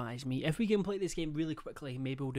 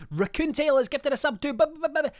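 high, loud hype announcer; 11 semitones higher, 8 dB louder than usual. Acted. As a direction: call it out like a club DJ. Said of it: "I said it like a D J".

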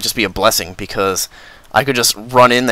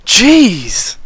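startled exclamation; 11 semitones higher, 6 dB louder than usual. Real, not acted. Panic relief.

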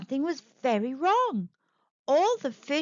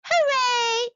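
high-pitched little cheer; 10 semitones higher, 4 dB louder than usual. A narrator's reading. Freed fish celebrate their rescue, high cheer. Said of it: "cheered the fish".

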